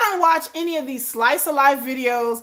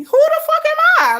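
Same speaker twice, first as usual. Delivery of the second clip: high squeaky mock diva voice, louder; mocking rude star; 7 dB louder than usual. Mocking the ignored fan's complaint, high put-on voice. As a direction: sneer it in a shrill put-on voice.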